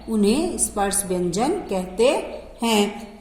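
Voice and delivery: ordinary delivery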